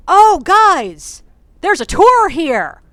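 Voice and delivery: loud, high shout to the group; calling out. Retold or imitated. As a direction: imitate a passerby hollering to friends. Passerby calls out to his group.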